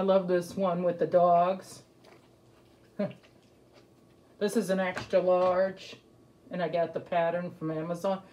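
muffled by fabric over mouth; speaking behind a face covering; through a cloth mask. Real, not acted. Seen 5: Cloth mask worn over mouth and nose; removed by 8.8.

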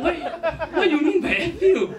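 high, indignant mock question; imitated shocked Singlish woman. Shocked girl questions him.

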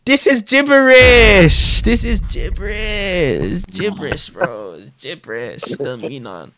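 lightly voiced scoffing skeptic; Sneeko impression. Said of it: "So guess what he's gonna say?".

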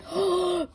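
sharp high gasp of surprise; a sudden delighted intake of breath. Real, not acted. Goosebumps at the card.

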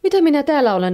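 high, loud burst of bewilderment; astonished. A narrator's reading. Neighbour suddenly finds herself elsewhere, astonished high outcry. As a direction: pipe up in startled wonder. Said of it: "naapuri ihmettelee".

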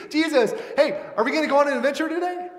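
put-on high, loud, racing kid voice; imitated excited child. Little kids coming to Jesus, mock-excited. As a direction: laugh and blurt like an eager kid.

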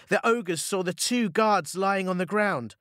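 ordinary delivery, nothing marked

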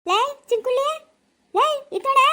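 high, sharp summons; calling out. Acted. (ಏರುದನಿಯಲ್ಲಿ ಕೂಗಿ ಕರೆಯುವುದು)

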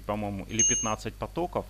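ordinary delivery

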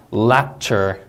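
"lecture" with a clean L and the stress on "LEC"; clear L, first stress. Shown as a demonstration. Teacher modelling the L sound and stress. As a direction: say "lecture" with a crisp L and weight up front. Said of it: "강세도 주의하셔야겠고 우리 L 발음도".

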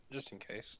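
very soft, in-character murmur; hushed little afterthought; whispered aside. Acted. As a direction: drop it to a near whisper. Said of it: "I whisper it away".

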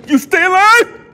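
loud, high shocked question; startled yelp. Real, not acted. Player startled by a figure coming alive, startled yelp. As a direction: blurt it out high in alarm.

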